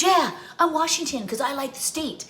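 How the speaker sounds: daughter's voice. Retold or imitated. Daughter announcing her team at the stairs, high and playful. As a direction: chirp it like a proud kid.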